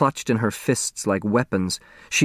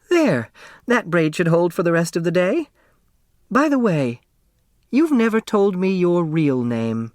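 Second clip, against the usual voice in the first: put-on raised, fond older woman's voice; elderly neighbor voice; 7 semitones higher than usual. A narrator's reading. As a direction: voice the chatty old neighbor, higher. Flora finishing the braid.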